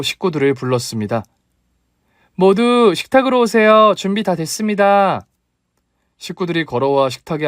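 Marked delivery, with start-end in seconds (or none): 2.3-5.3 calling out. Acted. Calling family to the table.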